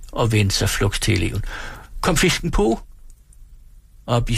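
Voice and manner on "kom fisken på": higher, fretful fussing voice; a flustered landlady wailing at the pot; flustered hostess. Acted. Frantic hostess fussing at the stove that won't boil.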